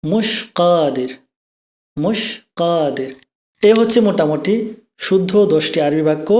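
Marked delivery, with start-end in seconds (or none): 0.0-3.1 মুশ কাদির said slowly, both words drawn out; teacher models colloquial মুশ কাদির at half speed; slow Arabic demo. Shown as a demonstration. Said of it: "তো এটার গ্রাম্য যে আরবিটা সেটা হচ্ছে".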